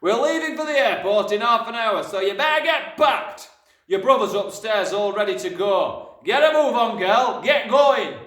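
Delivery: hectoring dad at full volume; bossy shouting father. A narrator's reading. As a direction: bellow at your daughter to hurry. Father orders daughter to pack.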